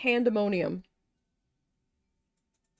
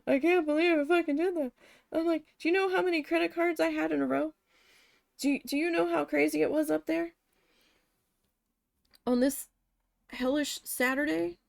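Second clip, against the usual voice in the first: higher, pleading disbelief at herself; exasperated rant; 5 semitones higher than usual. Retold or imitated. Cashier pleading with the manager, exasperated and raised. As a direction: vent the panic in a raised voice. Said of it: "I was like".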